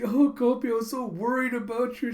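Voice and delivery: high-pitched, relieved cooing; gushing pet owner. Retold or imitated. Owner snuggles her returned cat, gushing with relief.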